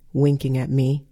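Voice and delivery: ordinary delivery, nothing marked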